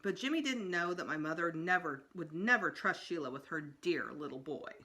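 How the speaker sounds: usual speaking voice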